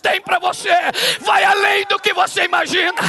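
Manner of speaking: rising shouted sermon climax; preaching at full force. Acted. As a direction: build to a shouting prophetic peak. (pregação inflamada, subindo até gritar)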